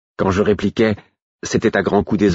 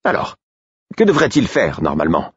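impatient king. A narrator's reading. Royal questions impatiently.

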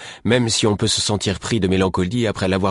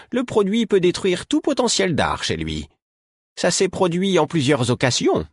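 put-on raised, exasperated old woman's voice; a high old healer lecturing two youths; old woman Caudron scolding; 8 semitones higher than usual. A narrator's reading. Caudron objects.

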